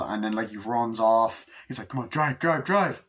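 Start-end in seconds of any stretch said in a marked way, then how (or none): 1.9-3.0 urgent urging. Retold or imitated. Acted urging to drive off.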